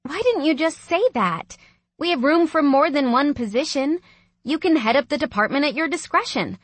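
high, eager, encouraging; excited sister making plans; enthusiastic. A narrator's reading. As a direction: pitch it up, delighted by the news. Sister enthuses over brother's plan.

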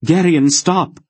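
high, loud cry of alarm; shouting stop. A narrator's reading. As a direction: yell to stop him, alarmed. Aunt Paul cries to stop him.

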